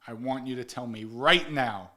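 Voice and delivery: ordinary talk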